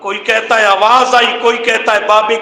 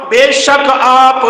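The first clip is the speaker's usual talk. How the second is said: normal talking voice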